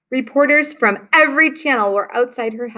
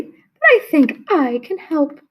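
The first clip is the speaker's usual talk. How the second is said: put-on higher sweet old lady voice; sweet old woman; 6 semitones higher than usual. Old woman offering the family help, kindly and high.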